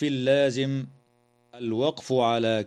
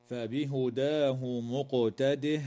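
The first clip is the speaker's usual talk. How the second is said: slow measured tajweed chanting; Quranic recitation; 4 dB quieter, slower than usual. Shown as a demonstration. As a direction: recite the verse slowly with tajweed. Verse recited with qalqala.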